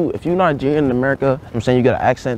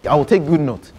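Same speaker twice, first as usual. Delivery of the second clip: put-on lightly held Nigerian accent, earnest student; a Nigerian student pleading with the teacher; 3 semitones higher than usual. To a geography teacher, a mild Nigerian accent. Persona in security class.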